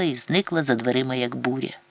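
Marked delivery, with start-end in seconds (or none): none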